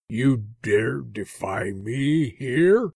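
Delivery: slow, menacing demon lord; slow and threatening, full of power; dark lord. Acted. Fiend lord threatens defiant underling.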